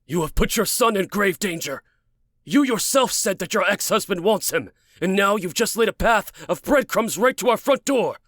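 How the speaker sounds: high, forceful accusation; furious tirade. Acted. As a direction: berate her for endangering the child. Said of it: "He stormed, feeling the sky change".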